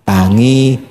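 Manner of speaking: low submissive echo; meek husband. Acted. Husband meekly echoing his wife's order, low. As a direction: repeat the order obediently.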